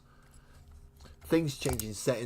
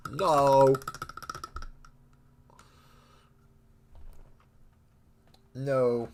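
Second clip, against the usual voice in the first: shouted, higher no; loud no; 7 semitones higher, 11 dB louder than usual. Real, not acted. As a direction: blurt it out loud and high. Hyper from Red Bull.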